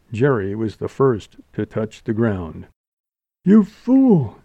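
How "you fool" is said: high, loud anguished reproach; pained, high-pitched outburst; moaned rebuke. A narrator's reading. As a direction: wail it at the one who hurt you. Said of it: "she moaned".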